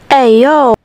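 sudden loud yell; loud shout. Acted. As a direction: blurt it out loudly. Sudden outburst at the cat.

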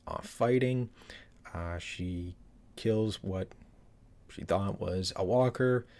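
ordinary talk

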